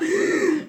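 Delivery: high panicked intake of breath; sharp gasp. Shown as a demonstration. Showing how she panicked on the chairlift, gasping. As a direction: show the hyperventilating gasp. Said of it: "like this".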